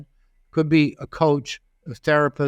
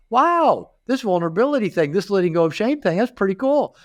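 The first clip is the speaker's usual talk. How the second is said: higher, mock-amazed wow; amazed inner voice; 7 semitones higher than usual. Thinking to himself about vulnerability, amazed and pitched up. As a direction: act out the thrill of discovery, pitched up.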